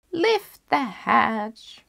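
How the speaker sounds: put-on very slow whispered old woman; grandmother calling. Grandmother calling to the visitor, slow and whispered. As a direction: whisper it slowly, frail. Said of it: "called out the grandmother".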